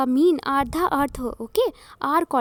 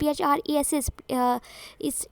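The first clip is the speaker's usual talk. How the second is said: ordinary talk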